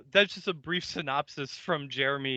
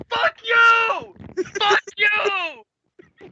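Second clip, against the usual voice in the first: loud, high, drawn-out yell; off-camera yell; 7 dB louder, much slower than usual. Acted. Watcher storming off camera, furious high-pitched yelling. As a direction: scream it as you storm out. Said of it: "gets up off camera and you just hear".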